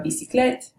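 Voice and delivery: bicyclette said the French way, bee-see-KLET; a light French bicyclette; French pronunciation. Shown as a demonstration. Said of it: "in French and English".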